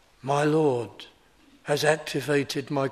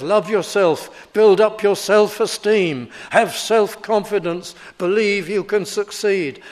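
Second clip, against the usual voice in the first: the world's voice; 5 semitones higher, 6 dB louder than usual. Retold or imitated. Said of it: "It's the world that says".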